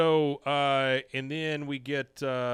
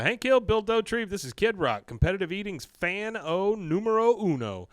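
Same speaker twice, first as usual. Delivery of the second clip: lightly acted event host, pitched up; hyped introduction; 7 semitones higher, 4 dB louder than usual. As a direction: introduce a celebrity with loud, high energy. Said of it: "Dan Vitti says".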